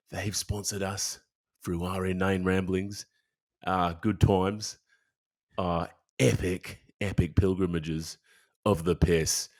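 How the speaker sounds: a mock Australian accent, playful ad read. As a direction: do your best Australian for the sponsors. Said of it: "in an Australian accent?".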